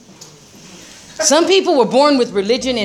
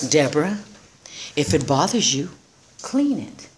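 lower, soft and calm, the sponsor's serene voice; calm spiritual sponsor; 6 semitones lower, 5 dB quieter than usual. Retold or imitated. Sponsor calmly telling Deborah to clean it herself. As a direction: say it like an unbothered spiritual man.

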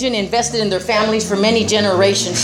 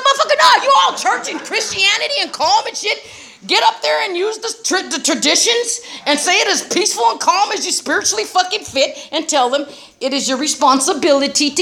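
outraged retort; 9 semitones higher than usual. Retold or imitated. Deborah yelling back at her calm sponsor, outraged. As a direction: fire back at him, indignant and high.

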